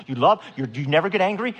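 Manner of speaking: usual speaking voice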